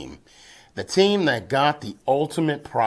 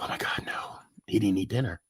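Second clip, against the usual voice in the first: whispering; 2 semitones lower, 8 dB quieter than usual. Retold or imitated. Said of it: "They'd be like".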